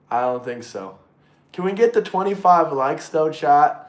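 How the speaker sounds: ordinary talk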